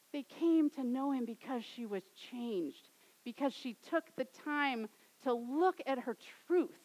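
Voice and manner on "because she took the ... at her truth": usual speaking voice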